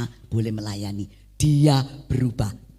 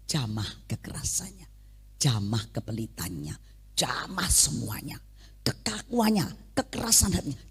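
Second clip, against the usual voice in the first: soft whispered plea; whispered prayer; 7 dB quieter than usual. Retold or imitated. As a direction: whisper the prayer under your breath. (doa yang dibisikkan pelan)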